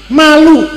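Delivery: sharp, high, loud "Malu"; emphatic. Acted. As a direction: hit "Malu" hard and high. Preacher stresses the word shame. ("Malu" diucapkan tinggi dan keras)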